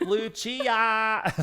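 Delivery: loud, high Scottish accent on the name. Shown as a demonstration. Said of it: "sing the Italian lyrics in a Scottish accent".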